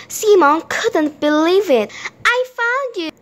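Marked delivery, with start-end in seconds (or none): 2.3-3.1 high-pitched delighted discovery; excited, much higher voice; joyful cry. A narrator's reading. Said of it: "he cried".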